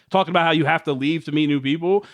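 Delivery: ordinary talk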